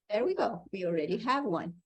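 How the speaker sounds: ordinary talk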